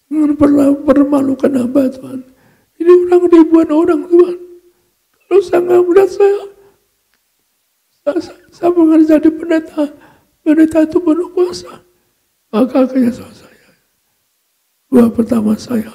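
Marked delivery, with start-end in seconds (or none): 0.1-11.8 high, pleading retold prayer; near-tearful prayer. Pastor begging God before thousands not to shame him, near-tearful prayer. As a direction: implore God with a lifted voice. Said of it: "Saya mampir nangis".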